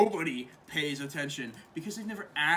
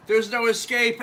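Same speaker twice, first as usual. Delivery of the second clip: louder, higher acted pronouncement; lightly hammed-up declaration; mock dramatic warning; 7 semitones higher, 8 dB louder than usual. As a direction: declare it loudly, as if onstage. Declaiming a character's line in retelling.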